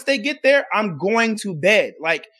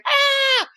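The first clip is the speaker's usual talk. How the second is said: short high mock scream; a quick high mock shriek; imitating a scream. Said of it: "some lady in the background scream".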